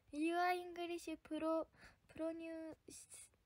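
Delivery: very slow, stretched-out English syllables; halting attempt at reading English; struggling to read English. Real, not acted. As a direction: sound it out and falter on the long word. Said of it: "むずいて".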